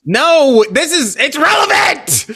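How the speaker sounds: high, loud insistent protest. Real, not acted. Defending after politics jab.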